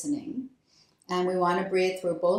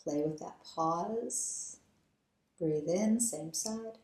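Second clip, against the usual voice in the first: guided breathing meditation. Acted. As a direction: lead them gently through each breath. Leads alternate nostril breathing.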